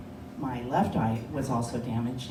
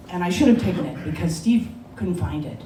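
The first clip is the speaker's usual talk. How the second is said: normal talking voice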